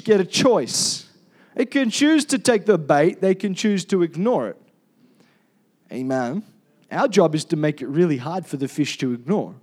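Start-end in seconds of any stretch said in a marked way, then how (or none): none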